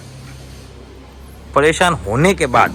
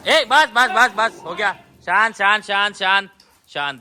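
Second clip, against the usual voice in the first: calming the dogs; 9 semitones higher than usual. Real, not acted.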